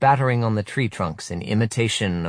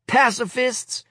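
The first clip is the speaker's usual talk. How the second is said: very high taunting cry; boyish cry. Acted. A third boy crying insult, high taunt. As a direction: cry it out as a sneer. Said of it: "cries a third".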